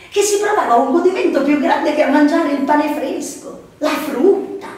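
loud, high, impassioned narration; intense climax. A narrator's reading. Narrator's story rises to climax.

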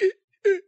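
high, loud strangled gasps; choking gasps. Shown as a demonstration. Showing friends how he choked on soda, strangled gasping. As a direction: gasp like your airway is full of liquid. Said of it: "like that".

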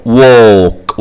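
ووك with long O and no L; silent L. Shown as a demonstration. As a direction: stretch the vowel in ووك, drop the L. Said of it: "وال L سايلنت". (ووك من غير L وبمد الـ O)

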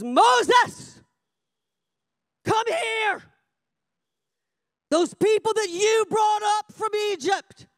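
high, forceful summons; angry God. Acted. God summoning Moses over the sinning people, angry. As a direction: call him over in wrath. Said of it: "God was so angry with them".

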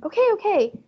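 high, quick reassuring voice; calm the trapped child fast; hurried mom. A narrator's reading. Mom freeing Fudge from the bed.

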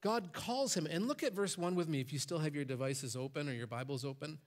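ordinary talk

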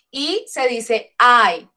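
first I as Spanish "ee", second I as English "ai"; English vowel name. Shown as a demonstration. Teacher showing students English I, slowly from "ee" to "ai". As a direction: slowly contrast I "ee" with I "ai". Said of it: "Pero en inglés no".

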